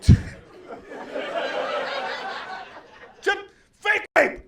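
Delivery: high, loud yell breaking into laughs; comic yelling at the crowd, laughing; yelling through laughter. Retold or imitated. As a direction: shout it while cracking up. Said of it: "Now I'm yelling at him".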